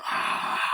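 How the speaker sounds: voiceless imitated scream; airy horror-film "aah"; mock breathy scream. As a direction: act out a scream without full voice. Demon scene acted out.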